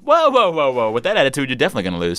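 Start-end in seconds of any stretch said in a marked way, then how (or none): 0.0-0.9 high, loud string of whoas; startled, high-pitched objection; alarmed protest. Real, not acted. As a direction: cut in to halt someone, pitched way up. Host halts contestant giving up.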